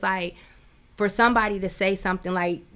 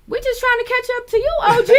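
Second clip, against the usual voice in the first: imitating her friend; 6 dB louder, faster than usual. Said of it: "and she was like".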